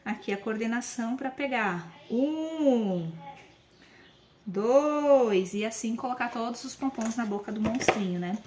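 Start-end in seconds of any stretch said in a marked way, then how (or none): 2.1-5.5 "dois" drawn out calmly and patiently; slow patient count. Shown as a demonstration. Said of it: "vamos contar mais devagar". ("dois" devagar e com paciência)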